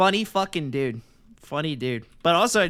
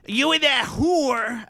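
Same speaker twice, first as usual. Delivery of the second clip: high, drawn-out mock Jersey Italian accent; Sopranos accent; 8 semitones higher, 4 dB louder, much slower than usual. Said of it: "we're watching The Sopranos".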